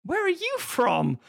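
a wildly exaggerated foreign-queen accent; Angelina Jolie's accent. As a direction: overact a wild mock accent. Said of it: "she takes on this accent".

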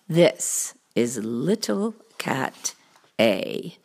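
low cartoon cat voice; deep storybook cat making introductions; showman cat. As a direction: present the helper in a deep, deliberate voice. Cat introduces his helper.

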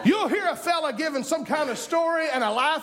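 high, driving preacher voice; impassioned pulpit delivery; fired-up preaching. Real, not acted. Preaches at full force.

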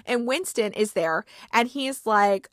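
usual speaking voice

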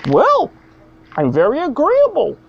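high mock voice, bloody stressed; hammed-up high Canadian pundit; Jordan Peterson impression. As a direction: parody him saying bloody. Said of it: "so you would say".

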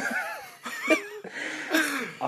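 long high laugh falling away; hearty laugh. Real, not acted. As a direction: burst out laughing, can't hold it. 笑いをこらえられない話の中. (大笑い)

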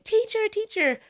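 put-on high kid voice calling out; kids calling teacher. Student tugging at the teacher, calling for attention. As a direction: call out like an eager little kid.

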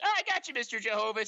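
high, excited prank reveal; gleeful gotcha. Retold or imitated. Prankster kid to neighborhood dad, gleeful gotcha.

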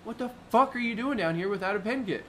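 loud, high, incredulous retold shout; berating them for coming unprepared; angry yell. Guide shouting at boaters who brought no pin kit. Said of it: "I was so mad".

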